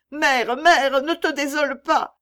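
put-on high, loud child's cry. A narrator's reading. Child consoling his distraught mother, high anxious cry. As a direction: call out urgently as a child. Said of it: "cria l'enfant".

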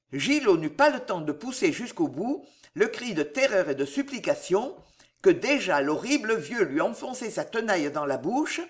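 ordinary delivery

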